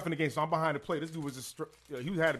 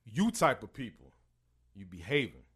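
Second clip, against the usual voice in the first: slow, weighted stress on YOU; emphatic; 5 semitones lower, slower than usual. Retold or imitated. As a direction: lean hard on the opening "You", then drop off. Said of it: "he said it with some emphasis".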